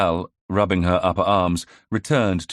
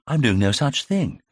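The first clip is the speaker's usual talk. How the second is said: indignant, pitched up in protest; righteous denial; 7 semitones higher than usual. A narrator's reading. Arby protesting righteously to Val, indignant. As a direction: insist you'd never do such a thing. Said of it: "he denied righteously".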